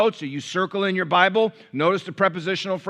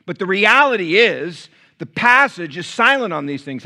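raised, high-pitched pulpit conviction; emphatic preaching; 4 semitones higher, 4 dB louder than usual. Real, not acted. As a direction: press it on the congregation with force. Preacher at full force.

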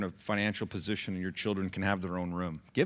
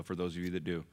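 ordinary delivery

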